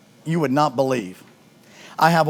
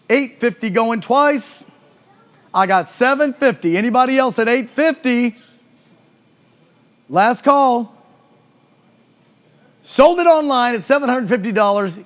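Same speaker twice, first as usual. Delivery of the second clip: auctioneer call; 6 semitones higher than usual. Real, not acted. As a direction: close the sale. Closing the sale.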